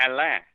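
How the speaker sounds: Alain said with no N at the end; French pronunciation. Shown as a demonstration. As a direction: demonstrate Alain, ending on an open "ah". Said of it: "It's pronounced Alain".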